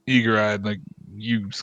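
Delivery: usual speaking voice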